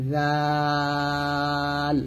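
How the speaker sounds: "জাল" thin and very drawn out; thin pronunciation. Shown as a demonstration. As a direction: hold a long, light "জাল", tongue at upper teeth. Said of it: "জালটা পাতলা করে উচ্চারণ করবেন".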